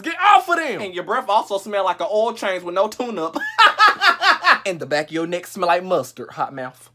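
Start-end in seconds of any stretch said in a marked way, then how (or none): 3.4-4.6 laughing burst. Real, not acted.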